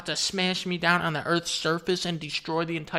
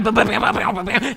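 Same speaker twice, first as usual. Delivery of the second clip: laser sound effects; 4 semitones higher, 6 dB louder than usual. Pretend.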